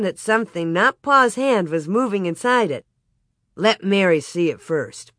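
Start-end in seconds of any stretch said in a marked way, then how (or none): none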